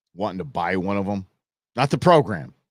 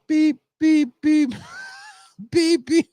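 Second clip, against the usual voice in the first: high beeps like phone buttons; chirpy imitation of a touch-tone phone; imitated keypad beeps; 5 dB louder, slower than usual. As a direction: sing out the keypad tones high.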